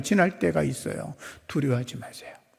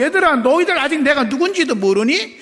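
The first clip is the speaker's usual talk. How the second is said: high, loud proclaiming voice; Jesus declaring himself God to the disciples, loud; bold declaration; 10 semitones higher, 5 dB louder than usual. Acted. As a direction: proclaim who you are with power. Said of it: "예수님 말씀은 그거예요".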